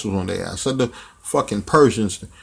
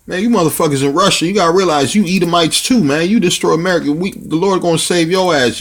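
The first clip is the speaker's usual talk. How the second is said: high, loud, hammering preacher's denunciation; preaching at full force; 5 semitones higher, 4 dB louder than usual. Acted. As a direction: thunder it out at the nations like a street prophet. Street preacher turns to denounce Russia.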